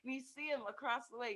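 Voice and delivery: ordinary delivery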